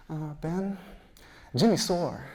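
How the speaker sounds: a hint of a small kid tattling; little kid. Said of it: "this kid walks up to us".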